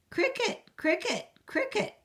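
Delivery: very high squeaky cricket chirps; chirping cricket. Acted. Teacher voicing the cricket for the class, high chirps.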